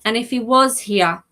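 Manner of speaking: ordinary talk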